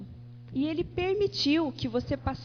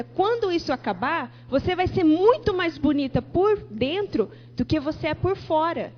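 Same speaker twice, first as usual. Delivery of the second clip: forceful prophecy; 2 semitones higher, 6 dB louder than usual. Retold or imitated. Prophesies healing to the couple.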